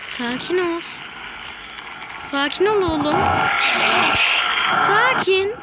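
hammed-up pet-soothing voice; mock soothing voice. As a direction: coax the beast down in a funny voice. Calming a wild mount.

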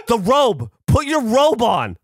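high, loud imitated urgent command, last words slow; urgent order. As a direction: insist he covers up right now. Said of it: "And she's like".

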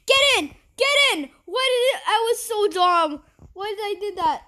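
high, loud urgent cries; frantic yelling. Real, not acted. Player urging character to get in, frantic yell. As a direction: yell in a panic at your own blunder. Urgent mistake during game.

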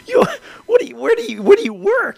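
asking in mock amazement where they work; incredulous. Gamer marvelling at YouTubers who buy everything, incredulous.

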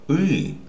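Y as a hard vowel, high then falling; Russian letter sound. Shown as a demonstration. Teacher wondering if this letter was taught, hard Y sound. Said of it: "if we learned the letter".

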